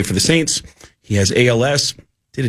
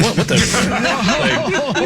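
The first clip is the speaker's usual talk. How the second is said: stunned laugh; 11 semitones higher than usual. Retold or imitated. Retelling a shocking joke.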